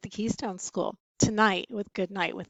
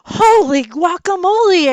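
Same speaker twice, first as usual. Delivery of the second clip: high, loud startled cry; sudden cry, much higher and louder; startled exclamation; 9 semitones higher, 16 dB louder than usual. A narrator's reading. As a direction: yelp it out in shock. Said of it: "exclaimed Skippy John Jones".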